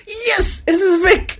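very high, loud squealed celebration; gleeful cheering. Pretend. Co-host celebrating that the character is gone, shrieked glee. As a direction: explode into shrieking triumph. Mock-emotional turn into cheering the death.

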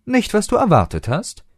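high, needling tease; teasing jab. A narrator's reading. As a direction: poke fun at him. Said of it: "stichelte River".

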